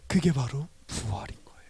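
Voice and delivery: soft whispered punchline; preacher's whisper; hushed whisper. Acted. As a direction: whisper it like a secret revealed. Sermon punchline on resurrection.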